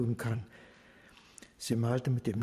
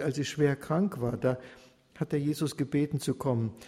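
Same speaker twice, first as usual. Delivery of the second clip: usual speaking voice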